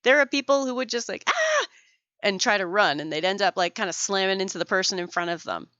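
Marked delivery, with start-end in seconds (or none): 1.3-1.7 short sharp mock scream of fright; startled scream. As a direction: yelp as if startled in a haunted house. Startled person screaming at haunted house.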